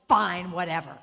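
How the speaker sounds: loud, exasperated giving in; hammed-up irritated concession; grudging mock surrender. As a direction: imitate a huffy, grudging yes. Woman gives in grudgingly.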